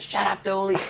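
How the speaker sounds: scolding the parrot. Retold or imitated. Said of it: "he goes".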